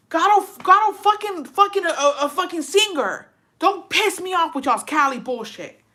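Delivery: high, loud furious rant; angry rant. Real, not acted. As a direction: tear into the commenter. Ranting at Cali commenter.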